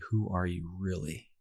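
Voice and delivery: a hushed, searching who are you really. Retold or imitated. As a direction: ask it again quietly so it sinks in. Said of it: "he's just like".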